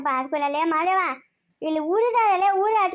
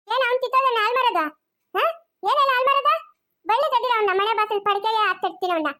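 angry retort; 3 semitones higher than usual. Acted. Acted angry retort in quarrel.

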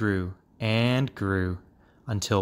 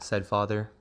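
ordinary delivery, nothing marked

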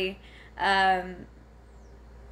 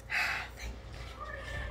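long sigh; 10 dB quieter than usual. Real, not acted. Regroups after rambling.

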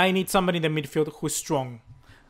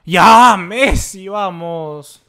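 loud urging cheer; loud excited urging; urgent cheer; 12 dB louder, much slower than usual. Real, not acted. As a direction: push the player on. Urging Messi during attack.

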